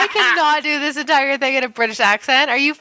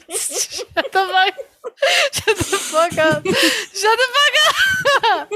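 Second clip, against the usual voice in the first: words breaking up with laughter; high, slow, helpless laughing protest; laughing hard; 8 semitones higher, much slower than usual. Real, not acted.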